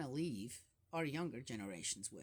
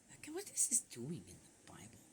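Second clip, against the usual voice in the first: hushed, breathy aside to the listeners; wondering whisper; 6 semitones lower, 7 dB quieter than usual. Real, not acted. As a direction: drop to a whisper as if sharing a secret doubt. Aside questioning a Bible passage.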